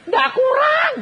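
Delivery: "Ndak kurang" shouted high and loud; emphatic shout. Retold or imitated. Preacher acting his proud answer to a pastor, shouting. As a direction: belt it out, nothing is lacking. Retold reply to a visiting pastor.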